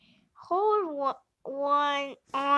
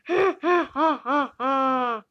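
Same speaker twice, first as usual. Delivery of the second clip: evil laugh; 6 dB louder than usual. Acted. Evil laugh after threat.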